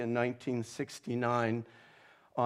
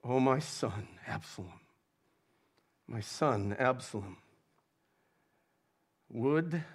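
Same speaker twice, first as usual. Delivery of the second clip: quiet weeping lament. A narrator's reading. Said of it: "and went up to the chamber over the gate and wept".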